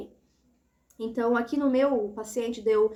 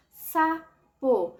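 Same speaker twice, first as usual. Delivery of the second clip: sapo split as SA... PO, a clear gap between; syllable by syllable; 5 semitones higher, 5 dB louder than usual. Shown as a demonstration. Teacher showing a child how to count syllables, sapo broken syllable by syllable. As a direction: say "sapo" in two slow, separate syllables. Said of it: "Quantas vezes você abre a boquinha".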